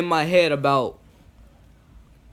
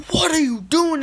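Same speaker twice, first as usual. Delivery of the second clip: mock angry father, pitched much higher and louder; mad parent; 13 semitones higher, 4 dB louder than usual. Father scolding his son over the hammer, imitated anger. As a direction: repeat the dad's scolding in a put-on voice. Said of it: "they get real mad at you".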